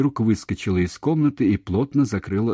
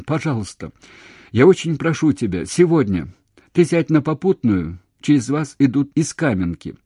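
loud, raised entreaty; urgent pleading; 6 semitones higher, 5 dB louder than usual. A narrator's reading. Man begging her to come today, urgent. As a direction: beg her to find a ride.

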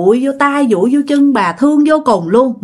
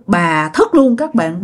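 ordinary delivery, nothing marked

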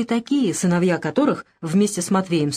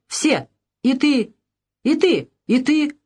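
slow, high-pitched accusing outburst; sudden shout; 6 semitones higher, slower than usual. A narrator's reading. Евдоким points at each old man, shouting suddenly. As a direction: fling each word as an accusation. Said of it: "вдруг закричал он".

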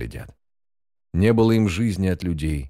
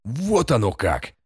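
higher, drawn-out indignant cry; scalded yell; 5 semitones higher, slower than usual. A narrator's reading. Henchman outraged at the prisoner's answer, indignant yell. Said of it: "как ошпаренный заорал Мишаш".

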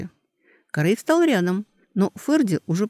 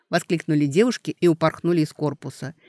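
plain reading voice

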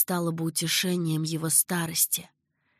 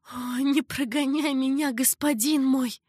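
pleading concubine; 6 semitones higher, 3 dB louder than usual. A narrator's reading. Concubine begs her lord.

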